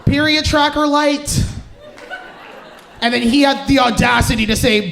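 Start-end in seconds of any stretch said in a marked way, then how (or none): 0.1-1.4 higher, cheery, proud of a bad plan; mock-confident higher voice; breezy reassurance. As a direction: reassure him brightly. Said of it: "I was like".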